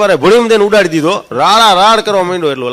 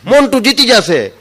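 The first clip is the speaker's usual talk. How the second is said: shouting at a race; 6 semitones higher, 3 dB louder than usual. Retold or imitated. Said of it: "Rada રાડો".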